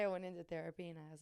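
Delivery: ordinary delivery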